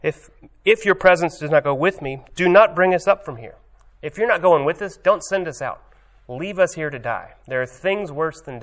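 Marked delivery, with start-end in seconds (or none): 0.0-5.5 louder, higher, desperate plea; Moses pleading. Retold or imitated. Moses protests he will not go without God, loud desperate plea. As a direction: demand God not abandon them. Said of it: "But he hears it".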